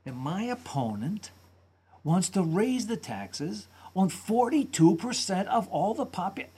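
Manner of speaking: imitating amazed McCain. Speech analyst imitating McCain's amazed inflection. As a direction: mimic a politician sounding amazed at everything. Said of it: "an inflection pattern of what I would describe as amazement".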